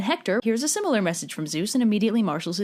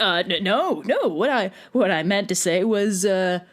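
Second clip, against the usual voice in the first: flustered backpedal; slower than usual. Acted. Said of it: "So Agamemnon's like".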